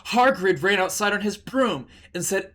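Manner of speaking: ordinary delivery, nothing marked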